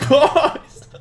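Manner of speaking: loud, high shout through laughter; laughing shout. Pretend. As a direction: yell it while cracking up.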